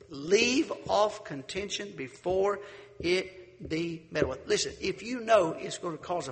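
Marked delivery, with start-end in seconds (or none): 0.1-4.5 slow, solemn verse reading; scripture reading. Acted. Preacher reading Proverbs to the congregation, slow and solemn. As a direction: read it like holy writ.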